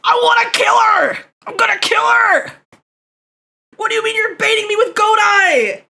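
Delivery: high, loud outraged yell; furious shouting. Real, not acted. Streamer baited by the wrong song, shouting furiously. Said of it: "I'm so mad!".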